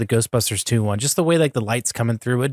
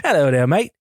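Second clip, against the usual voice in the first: put-on a quick, jokey Australian accent; half-hearted Australian accent, laughing it off; 3 semitones higher than usual. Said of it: "I'm not gonna try to do an Australian accent".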